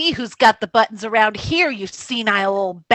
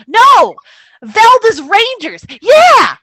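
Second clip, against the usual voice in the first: excited shouting; 6 semitones higher, 6 dB louder than usual. Acted. Velda shouting troop names.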